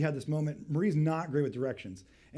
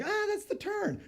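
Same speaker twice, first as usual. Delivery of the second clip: high, wavering "Ah, that's the turn"; unsure guessing; mock question. Husband imagining Marie unsure, high mock questioning. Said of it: "I thought she was like".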